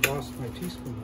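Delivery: normal talking voice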